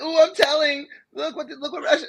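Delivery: high whiny mock tattling child; whiny tattletale. Mocking the US to a friend, high whiny tattling. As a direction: whine like a kid telling on someone. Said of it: "They're like".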